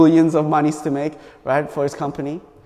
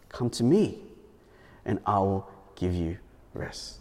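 tender; 9 semitones lower, 11 dB quieter than usual. Acted. Quoting Jesus softly.

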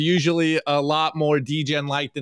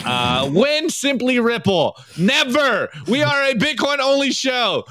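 mock outrage; 7 semitones higher, 5 dB louder than usual. Mock outrage at troll meme.

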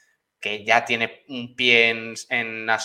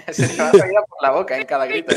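laughing; 4 dB louder, faster than usual. Real, not acted. Laughing about the commentator's screams.

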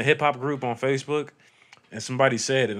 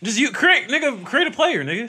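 excited urging; 11 semitones higher, 7 dB louder than usual. Real, not acted. Urging friend, voice jumps up.

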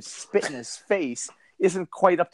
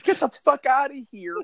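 mock Santa yell; 8 semitones higher than usual.

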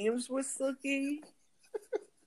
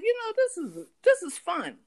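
high voiced child; 10 semitones higher, 4 dB louder than usual. Brother's cheerful quote, high voice.

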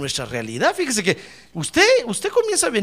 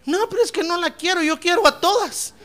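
high-pitched pleading objection; protesting young man; 11 semitones higher than usual. Acted. Young man refusing to marry just one, high protesting voice. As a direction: plead like a cornered young suitor.

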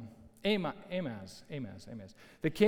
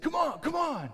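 much higher-pitched worked-up taunt; mock angry goading; 11 semitones higher than usual. Kickboxer getting worked up mid-fight, mock angry goading. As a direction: imitate a frustrated fighter egging his opponent on. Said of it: "he's getting more and more angry".

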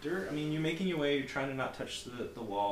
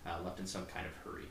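ordinary talk

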